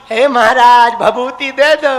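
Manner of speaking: slow, drawn-out begging of a holy man; mock pleading devotee. Guru mimics wandering devotees begging a baba, slow mock plea. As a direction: plead like a desperate pilgrim asking for ash.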